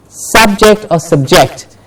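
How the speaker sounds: SUBject, then subJECT; stress contrast. Shown as a demonstration. Teacher lays out two options, stress shifting on subject. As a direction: say subject stressed first in front, then at the back. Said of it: "how I am pronouncing each word".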